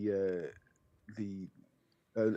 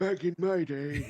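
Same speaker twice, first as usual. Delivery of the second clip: put-on high, loud old-man voice; old-timer act; 11 semitones higher, 5 dB louder than usual. Co-host teasing the host about his age, old-timer voice. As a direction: pitch it up like a reminiscing old geezer.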